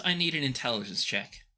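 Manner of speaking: ordinary talk, nothing marked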